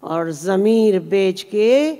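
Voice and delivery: drawn-out phrase endings with rising and falling lilt; ghazal recitation. Acted. Poet reciting a requested ghazal to the audience, measured lilting recitation.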